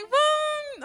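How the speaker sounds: high, loud punchy boom; a mock explosion of delight; triumphant boom. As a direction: land it like a victory shout. Excited retelling of a lucky moment.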